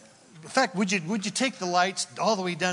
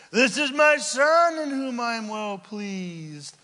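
booming voice from above, higher and louder; audible voice from heaven; 5 semitones higher, 5 dB louder than usual. Acted. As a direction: proclaim it like God speaking from heaven. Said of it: "An audible voice".

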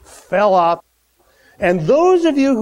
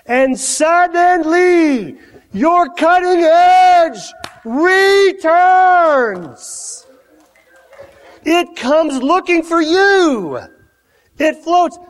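high, loud, slow preaching climax; preaching at full force; 9 semitones higher, 4 dB louder, much slower than usual. Acted. Preacher proclaiming to his congregation, high, loud and slow. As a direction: draw it out triumphantly to the congregation.

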